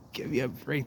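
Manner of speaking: breathless whispered laugh of disbelief. Real, not acted. Explorer marveling at the collapsed canal wall, laughing whisper. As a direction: laugh it out under your breath.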